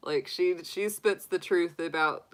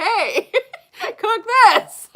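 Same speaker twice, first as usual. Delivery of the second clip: laughing; 12 dB louder, much slower than usual. Retold or imitated. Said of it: "We're just like".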